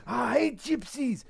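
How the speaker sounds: high, slow Russian snarl; imitating an enraged Russian; Russian accent. The Russian punching the wall, in a high Russian accent. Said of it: "he goes".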